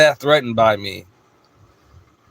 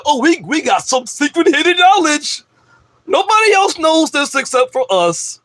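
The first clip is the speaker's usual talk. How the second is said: much higher, sneering smug voice; mocking smug ego; 11 semitones higher than usual. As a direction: parody the know-it-all ego. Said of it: "That's just your ego say".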